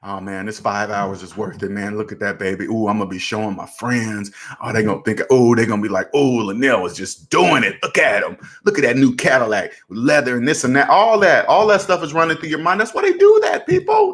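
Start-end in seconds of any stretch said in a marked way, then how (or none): 0.1-10.9 low-pitched daydreaming self-talk of a buyer; excited car buyer. Retold or imitated. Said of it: "I imagine myself back at the table". 12.8-14.1 very high-pitched burst to the audience; exclaiming. Real, not acted.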